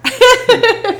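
loud, high laughing burst; burst of laughter. Real, not acted. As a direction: crack up out loud. Reacting to Royal Rumble joke.

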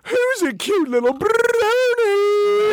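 put-on high, slow cooing baby talk. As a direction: coo like talking to a puppy. Mocking baby talk to pony.

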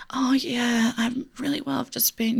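high-pitched, over-polite small talk; over-nice soft voice. Acted. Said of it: "this over nice, soft voice".

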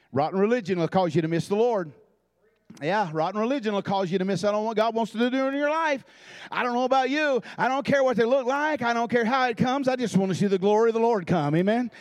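preacher swelling toward the amen; building preaching. Acted. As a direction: build the sermon to its peak.

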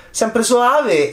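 "sempre soave" lifted high and drawn out on soave; lilting high. Shown as a demonstration. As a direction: float it up high, sweetly.

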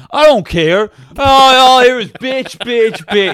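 loud, high angry yelling; shouting at Anna Friel, loud and high-pitched; furious shouting. Retold or imitated. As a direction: rant at her at full volume. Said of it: "He loses Mexican accent straight away".

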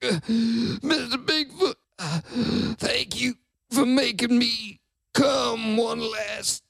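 gasping, air running out; short of breath, dying gasps. Acted. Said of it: "I'm running out of air".

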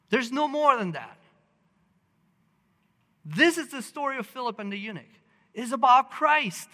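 high, loud, emphatic pulpit proclamation; impassioned preaching. Real, not acted. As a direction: preach it hard, landing heavily on key words.

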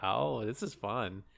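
low, soft and slow, sarcastic; slow deadpan. Pretend. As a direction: say it flatly, slowly. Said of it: "You're like".

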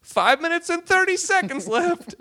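very high, loud mock announcement; countdown announcement. Comic bombing, reading his watch aloud, announcing. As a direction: squeal the time remaining. Retold countdown during bombed set.

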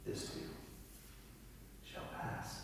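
slow, soft pastor's reassurance; calm grandfather. Retold or imitated. Grandfather answering someone who is struggling, calm and hushed. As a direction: say it slowly and quietly, like an unflappable old pastor. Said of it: "calm all the time".

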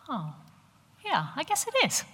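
high-pitched, hesitant mock agreement; imitating detached husband. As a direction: play the husband belatedly conceding. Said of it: "And he'll go".

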